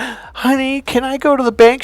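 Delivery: high, timid begging; imitating a meek husband asking permission; meek effeminate husband. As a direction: ask like a henpecked husband. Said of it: "his voice became, I wanna say, almost effeminate".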